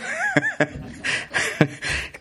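high falsetto giggle. Acted. Pastor giggles high in the Italian lady's voice. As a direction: squeal with laughter. Laugh in put-on voice.

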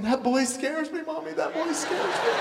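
high, tiny frightened voice with a laugh; scared baby shark. Retold or imitated. Preacher voicing a baby shark afraid of his son. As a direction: squeak like a timid baby shark. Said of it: "this baby shark in the back tank going".

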